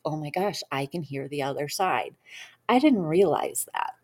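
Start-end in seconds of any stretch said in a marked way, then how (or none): 0.1-2.2 hushed, much softer awe; quiet amazement at a revelation; floored. Retold or imitated. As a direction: breathe it out, stunned by the discovery. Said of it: "And I was floored".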